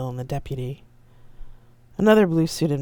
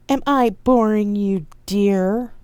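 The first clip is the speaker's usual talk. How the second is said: louder, pointed, offended partner; loud and indignant; 6 semitones higher, 7 dB louder, slower than usual. Acted. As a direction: call it out loud for all to hear. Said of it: "and said loudly".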